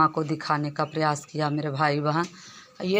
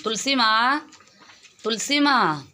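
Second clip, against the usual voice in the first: loud, raised call across the yard; 6 semitones higher, 6 dB louder than usual. Real, not acted. Woman calling her cow Tulsi across the cowshed, loud raised call. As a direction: call the cow's name so she comes, pitched up.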